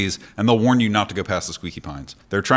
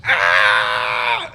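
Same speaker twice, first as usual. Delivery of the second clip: mock battle cry; 5 dB louder than usual. Doctor charging past.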